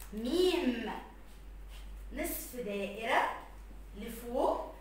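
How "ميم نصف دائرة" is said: normal talking voice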